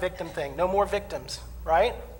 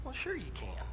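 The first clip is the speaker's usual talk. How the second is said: soft whispered politeness; hushed sweet reply; 3 semitones higher, 14 dB quieter than usual. Retold or imitated. Young minister politely whispering to a congregant.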